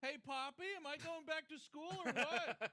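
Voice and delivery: high, whiny spoiled son; bratty kid. Pretend. Spoiled son asking his father about school, high whine. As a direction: whine at your dad like a spoiled brat. Voicing rich kid to dad.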